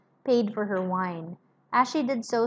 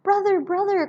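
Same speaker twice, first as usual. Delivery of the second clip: much higher, louder cry of brother; pleading with her brother to hear her; 10 semitones higher, 5 dB louder than usual. Acted. Miss Pross crying out to her cold brother, much higher and louder. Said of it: "cried Miss Pross".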